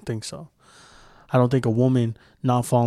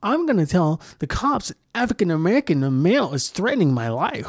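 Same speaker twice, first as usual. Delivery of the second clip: higher, put-on voice of a frightened caller; mock panicked caller; 6 semitones higher than usual. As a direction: play the woman crying wolf to the cops, a lightly voiced mock. Mocking the woman's phone call.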